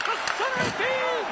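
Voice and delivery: sudden high excited call. Real, not acted. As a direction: pitch shoots up on feed. Goal-mouth chance in play-by-play.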